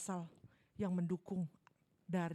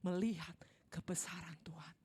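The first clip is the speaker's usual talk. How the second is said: whispered; 3 semitones lower than usual. Acted.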